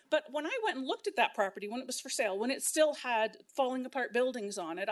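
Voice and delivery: normal talking voice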